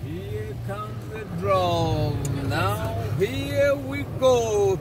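very slow, stretched-out words; drawn-out announcing. Real, not acted. Announcing to the riders the drop is coming, drawn-out call. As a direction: draw every word out to build suspense.

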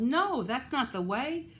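loud, drawn-out protest; high, slow mock yell; storybook yell. A narrator's reading. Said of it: "The children yelled".

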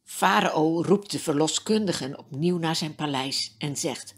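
ordinary delivery, nothing marked

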